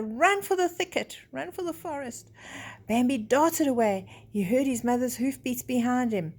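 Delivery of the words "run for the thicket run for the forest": high, urgent call to flee; urgent plea. Acted. Mother urges Bambi to flee the hunters, urgent. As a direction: push your child to run for its life.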